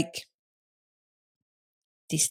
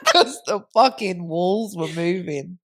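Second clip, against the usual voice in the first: laughing. Real, not acted. Telling her friend the walls were moving, laughing. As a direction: laugh through it.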